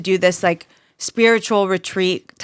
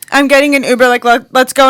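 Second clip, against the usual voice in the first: higher, louder urgent let's go; upset friend; 5 semitones higher, 6 dB louder, faster than usual. Retold or imitated. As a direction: push to leave now. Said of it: "she's like".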